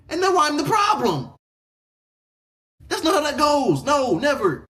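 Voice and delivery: high, loud, outraged shouts; the hurt boyfriend finally blowing up at her; furious yelling at girlfriend. Acted. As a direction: let her have it, yelling high and hard. Speaking as OP to girlfriend.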